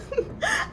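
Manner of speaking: high light laugh; a quick laugh. A narrator's reading. Teacher laughing at Lewis, fond laugh. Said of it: "Missus Bird laughed".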